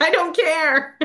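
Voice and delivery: high-pitched retold brush-off; playful insistence. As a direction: wave the objection away, high and amused. Retold eager answer, amid laughing.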